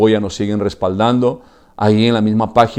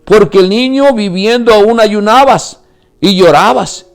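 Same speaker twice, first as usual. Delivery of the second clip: high, loud, impassioned sermon; preaching at full force; 11 semitones higher, 5 dB louder than usual. Acted. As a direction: pour it all out to the congregation. (predicación a todo pulmón, aguda y fuerte)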